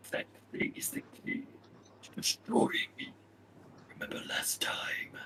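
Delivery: a menacing, hissing question; dark lord whisper. Acted.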